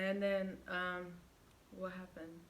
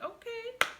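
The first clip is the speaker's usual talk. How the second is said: very high, louder sarcastic okay; defiant okay; 7 dB louder than usual. Retold or imitated. Accepting his dare to walk home, defiantly.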